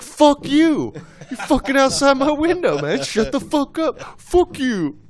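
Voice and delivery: hammed-up shouting while laughing; laughing mock shout. Retold shouting at guy outside.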